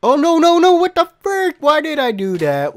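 very high, loud screaming; horrified outburst. Real, not acted. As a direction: scream in dismay at your own mistake. Botched double jump.

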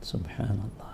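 breathless whispered subhanallah; awed whisper. Real, not acted. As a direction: whisper it in wonder. Awed at the word's meaning. (അത്ഭുതത്തോടെ മന്ത്രിച്ച്)

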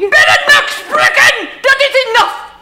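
furious shout at her daughter. Acted. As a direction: bellow her full name to stop her. Grandmother scolding Bella.

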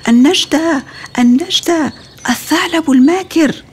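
high, loud cry for help; chick shouting for help. A narrator's reading. Smallest chick screams for his mother as fox attacks, loud and high. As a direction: yell it out to bring mother back. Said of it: "صاح الفرخ الأصغر".

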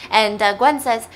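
usual speaking voice

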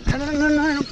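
high, alarmed no no no; real panic the fish is escaping; panicked. Real, not acted. Fish nearly lost, panic.

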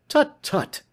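high-pitched, scolding exclamation; impatient cry. Acted. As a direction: cut off the despair with a high cry. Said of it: "cried Sherlock Holmes".

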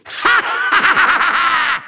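long rattling villain laugh; theatrical rapid-fire evil laughter; evil cackle. Acted. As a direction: cackle in gloating triumph. Villain gloats.

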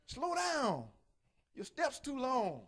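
high, slow pleading; tired walker pleading. Retold or imitated. Tired walking partner begging friend to slow down. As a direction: beg your friend to slow down.

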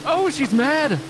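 very high, frantic squeal; panicked warning. Real, not acted. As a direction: warn your friends in a squeaky panic. Warns team the monster is here.

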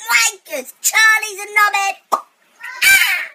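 loud squeaky high-pitched shouting; helium voice. Real, not acted. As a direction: yell insults like a chipmunk. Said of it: "Tourette's on helium".

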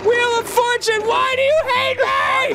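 very high lament at the game; exasperated wailing. Real, not acted. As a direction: wail it as a plea to fate. Pleading with the game.

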